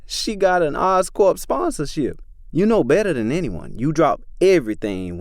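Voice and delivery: Becky talking down to Tori, lightly voiced. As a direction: lightly play her as smug and certain.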